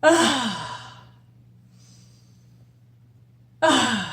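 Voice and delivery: big loud sighing breath out; loud exhale. Shown as a demonstration. Coach showing viewers the release breath, loud ah. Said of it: "three big breaths".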